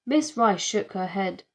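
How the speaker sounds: ordinary delivery, nothing marked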